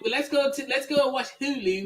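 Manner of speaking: mock Baltimore accent, lightly put on; playful put-on Baltimore vowels; joking accent. Host teasing cohost, mock Baltimore accent. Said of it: "You sound like you from, like, London somewhere".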